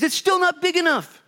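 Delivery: high, loud mock whine; whiny complaint. Preacher mocks tribes crying the land is too small, whiny. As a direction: whine like a kid with soggy cereal.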